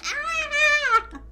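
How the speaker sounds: a playful shriek of horror; mock scream. Prince screaming back at the girl, a mock shriek. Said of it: "looks at her screaming and is like".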